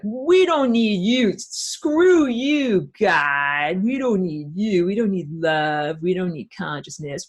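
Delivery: slow, exaggerated "screw you" swagger; mock defiance. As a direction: act out haughty rebels rejecting everything. Mocking quote of defiant invaders.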